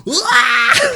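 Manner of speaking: very high, loud mock baby scream. Imitating BB screaming at the grenade explosion. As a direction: shriek like a startled infant. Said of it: "B B's like".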